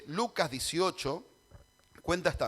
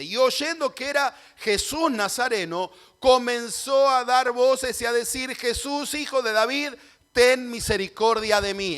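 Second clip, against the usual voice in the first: higher, louder ringing scripture reading; clamor proclamado; 5 semitones higher, 7 dB louder than usual. Acted. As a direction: proclaim the blind man's cry at full voice. Relee el clamor de Bartimeo. (lectura clamorosa, voz alta y aguda)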